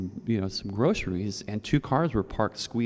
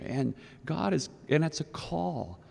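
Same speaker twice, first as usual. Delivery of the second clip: ordinary talk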